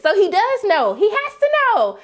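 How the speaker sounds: high, insistent conclusion; emphatic realization. Real, not acted. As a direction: insist on it, pitch jumping up. Realizes Nate knows, insisting.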